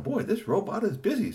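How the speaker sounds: high chirpy robot voice; busy robot. Acted. Robot voice in story.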